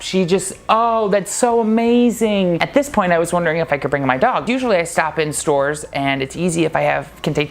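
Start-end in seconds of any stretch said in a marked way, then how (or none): 0.7-2.6 higher, slow, lit-up delight. Retold or imitated. Eve hearing he has a husband, delighted gush. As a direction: gush with joy. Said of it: "she just lit up".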